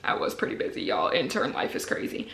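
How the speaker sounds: quick whispered aside to viewers; softly confiding aside. Real, not acted. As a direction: whisper it behind your hand. Aside while reading the card.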